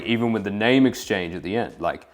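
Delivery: ordinary talk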